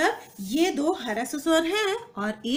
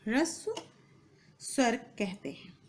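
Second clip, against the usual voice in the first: softer, pause after the first word; spaced dictation; 6 dB quieter than usual. Shown as a demonstration. Teacher repeating term for students' notes, spaced dictation. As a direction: set ह्रस्व apart for note-taking.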